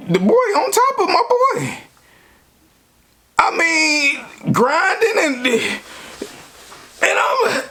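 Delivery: can't stop laughing at what he saw; laughing in disbelief. Real, not acted. Telling how he found his boy with another man, laughing. Walking in on the scene.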